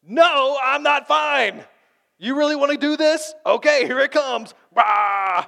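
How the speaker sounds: high, loud yelling outburst; screamer. Retold or imitated. Pastor acting the screamer at full yell. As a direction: blow up and let it all out. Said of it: "the screamer is the only honest one".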